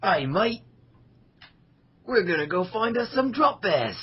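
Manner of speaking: hammy Aussie bloke off hunting drop bears; joking Australian voice for friends; mock Australian. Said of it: "Try a New Zealand one now".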